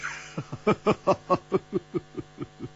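long breathy hearty laugh; big laugh. Acted. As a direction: laugh hard, triumphant. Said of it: "胡铁花大笑".

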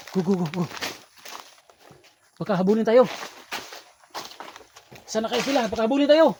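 panicked shout. Acted. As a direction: yell in panic while running away. Fleeing from bandits.

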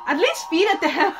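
high, loud objection; loud scolding. Real, not acted. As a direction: scold them from the sidelines. Scolds the person in the video.

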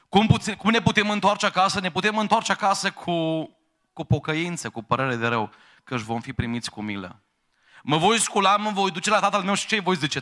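impassioned pulpit climax; preaching at full force. Acted. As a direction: lift the whole hall with urgent pleading. Preacher climaxes on the prodigal son.

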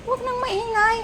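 high pet-talk hush; high scolding. Real, not acted. Hushing the dog.

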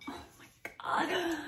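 a high, breathy whispered gasp of delight; breathless whisper. Real, not acted. Collector admiring scalloped bowl detail, whispering in awe. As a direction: swoon under your breath. Swooning over scalloped bowls.

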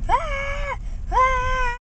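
high mock screams while falling; panicked screaming. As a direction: scream as you tumble. Toy falling down hill.

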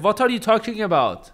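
put-on high, puzzled dad in an Iranian accent. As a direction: ask it like a confused Iranian father. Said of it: "he got that Iranian full accent".

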